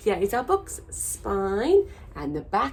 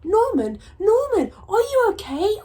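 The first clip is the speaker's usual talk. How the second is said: worried call; 14 semitones higher, 7 dB louder than usual. Acted. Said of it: "asked the snails".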